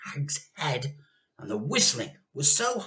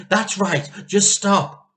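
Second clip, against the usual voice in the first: pleading; 4 semitones higher, 9 dB louder, slower than usual. Acted. Pleading with grandma.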